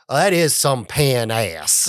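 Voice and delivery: "pan ass" said slowly, split into two words; mispronounced panache. Retold or imitated. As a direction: drawl "some pan ass" proudly and wrongly. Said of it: "Mister Schultz".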